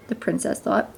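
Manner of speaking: usual speaking voice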